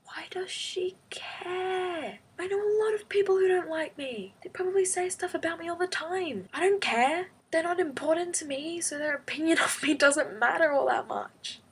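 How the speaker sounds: high, breathy start easing into a shrugging dismissal; dismissive disbelief. Retold or imitated. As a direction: brush it off, voice pitched high and incredulous. Retold reply, dismissing Stacey's upset.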